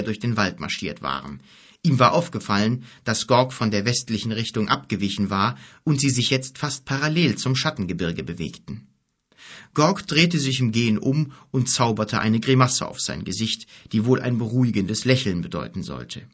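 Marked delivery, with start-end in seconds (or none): none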